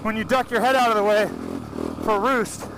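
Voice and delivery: talking inside a helmet; muffled in helmet. Real, not acted. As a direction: speak through a helmet mic, muffled. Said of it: "we actually put a mic in the helmet".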